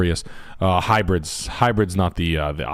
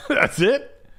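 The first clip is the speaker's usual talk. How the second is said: incredulous laugh. Real, not acted. Mocking a short sentence.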